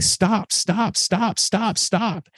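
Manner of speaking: put-on reliving the scared little boy shouting stop; childhood yell. Scared little boy shouting at fighting parents, high and strained. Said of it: "I'm yelling".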